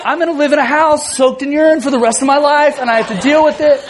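freaking out. Retold or imitated. Dad watching urine seep into tile cracks, freaking out. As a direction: act out a dad mildly losing it. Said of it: "I'm, like, freaking out".

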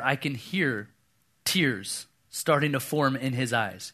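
ordinary delivery

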